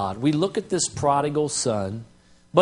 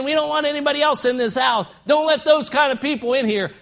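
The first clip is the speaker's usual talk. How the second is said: high, fast, snobbish shout; mock snooty shout; 7 semitones higher, faster than usual. Pastor mocks snooty church members shutting people out, sneering shout. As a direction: sneer them away from the door.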